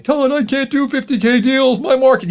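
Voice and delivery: high, pleading doubter; mock the listener who says he can't; hammed-up high complaint; whiny listener. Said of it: "if you're like".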